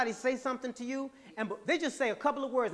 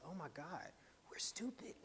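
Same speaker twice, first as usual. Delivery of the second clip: breathy unvoiced whisper, far quieter; whispering subconscious; 5 semitones lower, 18 dB quieter than usual. The subconscious mind, whispering in alarm to itself. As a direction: hush it like a frightened inner voice.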